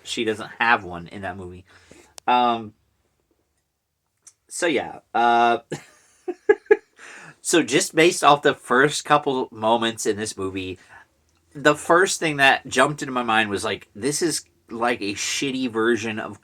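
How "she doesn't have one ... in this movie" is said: normal talking voice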